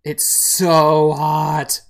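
"so" drawn out long and loud; stretched SO. Acted. Player reacting to the bite, long loud "so". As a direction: lean hard and slowly on "so".

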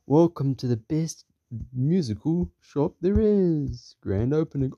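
slow, high-pitched grand welcome; shop announcer. Acted. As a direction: proclaim it to a crowd. Character announces shop to customers.

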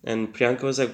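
ordinary talk, nothing marked

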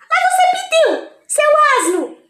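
high, loud scolding wife; furious wife. Acted. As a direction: yell at the husband, outraged. Wife keeps scolding husband.

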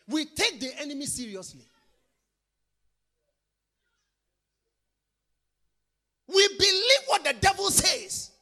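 loud, half-sung preaching; chanted sermon build; full-force preaching. Acted. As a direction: lift the rebuke over the music. Preaching over music.

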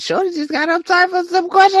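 high, squeaky mock disbelief; put-on high voice, playing the stunned one; mock baffled voice. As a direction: squeak it out, acting stunned by the question. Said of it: "So I'm sitting here like".

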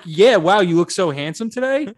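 loud, gushing fake flattery; gushing compliment. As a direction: gush over him like a date. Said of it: "and you're like".

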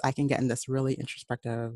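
ordinary talk, nothing marked

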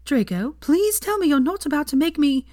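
high, loud, pleading in alarm; horrified plea. A narrator's reading. Hermione pleading with Draco in horror. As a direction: beg him not to.

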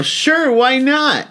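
high, slow, breezy shrug of a reply; casual shrug. Retold or imitated. Comic answering a Sony man, high drawn-out shrug. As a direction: toss it off like it's nothing.

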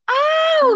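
high, loud dramatic "Au!"; overwrought soap opera yelp. Retold or imitated. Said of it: "kayak sinetron gitu". (teriakan "Au!" lebay ala sinetron)

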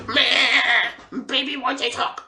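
high mocking baby voice; mock baby whine. Mocking the coworker as a whiny baby. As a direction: sneer it like talking to a toddler.

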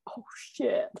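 breathy whispered gasp of shock; an imitated hushed "oh, shit" of surprise; stunned whisper. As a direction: mouth it in stunned disbelief. Stunned reaction quoted from Missus Baker.

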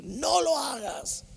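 high, drawn-out shouted warning; retold warning shout. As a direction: re-enact a shout that wakes a sleeper. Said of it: "Le grité en un sueño".

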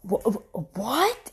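retold stammering shock; stunned disbelief. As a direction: stumble into a shocked question. Retold shock at son's news.

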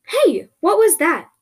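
high, loud, slowed exclamation; startled shout. Acted. Something just whooshed past, startled shout. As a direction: call out in alarm.

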